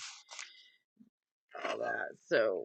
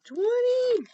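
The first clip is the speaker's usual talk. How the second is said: surprised; 9 dB louder than usual. Real, not acted. Surprised at her own score.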